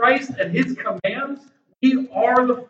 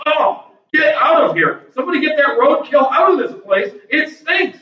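higher-pitched, repulsed outburst; disgust; 6 semitones higher, 4 dB louder than usual. Acted. Preacher voicing people who reject Paul's message, acted disgust. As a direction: order the stinking thing out in revulsion. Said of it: "in disgust and saying".

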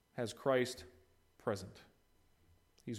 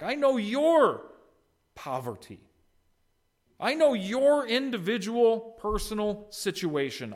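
emphatic preacher voicing Christ, higher and louder; 7 semitones higher, 5 dB louder than usual. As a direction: press each "know" hard as Christ's promise. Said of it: "It's very emphatic".

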